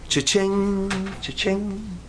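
soft ringing cha-ching, held ending; quiet sing-song register noise; cash-register imitation. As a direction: imitate the till ringing up money.

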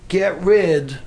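ordinary talk, nothing marked

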